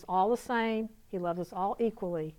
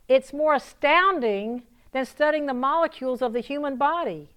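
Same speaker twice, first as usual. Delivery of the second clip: high, loud, emphatic preacher delivery; impassioned preaching; 5 semitones higher, 6 dB louder than usual. Real, not acted. Preacher telling the audience of love's power, fervent proclamation. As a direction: proclaim it with rising fervor, stretching key words. Preaches at raised pitch and force.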